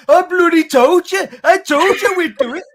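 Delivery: loud, high gloating Brummie fan; mock gloating shout. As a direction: crow it like a smug winner. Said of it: "He's like".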